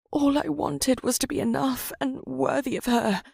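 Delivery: high, breaking, dying voice; last words fading away; dying mutter. Acted. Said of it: "he muttered in his dwindling breath".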